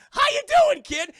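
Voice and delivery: high, loud, cackling clown greeting; a sinister toy leering at the boy; creepy clown. Acted. Clown voice again.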